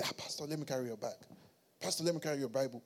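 soft, quick offer; eager helper offering. Acted. Church member to pastor offering to carry the bag, humble and soft. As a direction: murmur it quickly and low.

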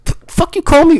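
very high, indignant outburst; hammed-up offended inmate; acted indignant objection. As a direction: snap back as if insulted. Inmate objects to being called Ruben.

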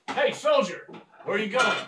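guard shouting. Acted. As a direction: bark a halt at a soldier. Guard stops the player.

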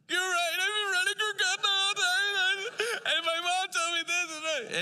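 high, loud imitated confession; Mehmet's confession. Young man Mehmet confessing to the preacher, high loud imitation. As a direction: voice the young man admitting it all at once. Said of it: "he's like".

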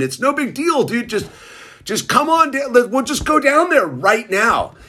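high, eager pitch; quoting a pushy friend selling a car, pitched high; quoting Dave. Retold or imitated. Dave's pitch, quoted eagerly.

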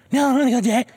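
put-on high, whiny begging of a frightened little boy; scared pleading kid. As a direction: beg like a kid too scared to go to the door. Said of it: "I was just like".

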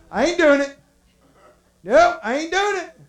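emphatic refusal. Acted. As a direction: refuse loudly, like a spooked pallbearer. Imagined pallbearers refusing to go on.

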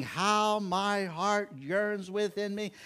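high, slow, drawn-out excitement; excited yearning. Retold or imitated. Job yearning for resurrection day, excited and drawn out. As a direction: stretch the words as if savoring the hope. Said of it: "Job is just as excited".

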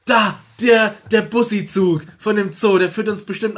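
excited pointing out. Acted. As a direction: shout out what you just spotted. Entdeckt den rettenden Zug. (aufgeregt und laut gerufen)

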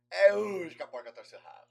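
comic dubbed voice, punched out loud; Mário Monjardim. Retold or imitated. Imitating the Brazilian dub of the bathtub scene, loud and comic. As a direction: burst into a hammy cartoon-dubber exclamation. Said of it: "Quando passa pro português, o cara fala assim".

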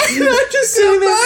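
high, laughing till it cracks; almost crying with laughter. Real, not acted. Telling the story, in tears of laughter. Recalling the absurd scene.

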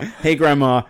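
loud American hello; a brash Yank greeting his grandma; American accent. Acted. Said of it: "a bit of an American accent".

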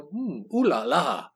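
slow, put-on French accent; mock French gentleman savouring a drink; drawn-out caricature French accent. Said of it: "with a French accent, going".

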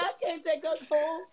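amused joking. Retold or imitated. Joking the dog speaks Spanish.

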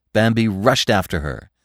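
ordinary delivery

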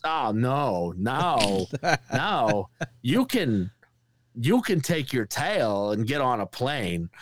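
halting star impression, pauses between phrases; Christopher Walken. Imitating a star correcting a comedian, halting impression. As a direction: separate each refusal, then explain slowly. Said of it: "He goes".

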